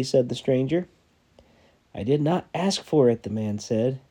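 measured storytelling read, dramatic pauses; sermon story read aloud with quoted voices; story narration. A narrator's reading. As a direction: read it like a parable to the church. Pastor reads story aloud to congregation.

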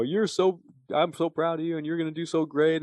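high, eager encouragement; gushing praise. Retold or imitated. Writing encouragement to the recovering teen, high gushing praise.

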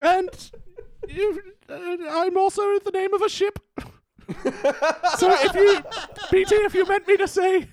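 very high falsetto royal voice; hammed-up monarch in a piping falsetto; Queen Elizabeth. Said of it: "let's get some Queen Elizabeth".

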